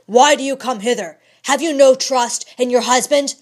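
loud, fast, urgent outburst; impetuous cry. A narrator's reading. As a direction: fling the words at her in a rush. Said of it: "cried he impetuously".

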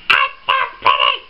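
high burst of delight; real high-pitched excitement; thrilled squeal. Real, not acted. Thrilled by the next gift.

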